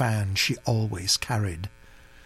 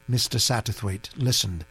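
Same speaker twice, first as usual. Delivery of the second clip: ordinary delivery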